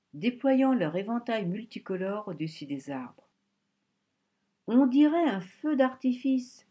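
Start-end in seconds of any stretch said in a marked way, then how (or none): 4.7-6.7 louder, higher and slow, full of wonder; a girl gasping at fireworks, louder and slower; enraptured. A narrator's reading. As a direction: marvel at the sky, drawing it out. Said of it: "s'extasia Charonne".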